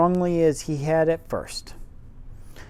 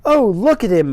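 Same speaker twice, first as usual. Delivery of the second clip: put-on bright loud girlish cry, higher; excited exclaim; 5 semitones higher, 8 dB louder than usual. Mary delighted by the robin, loud exclamation. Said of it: "exclaimed Mary".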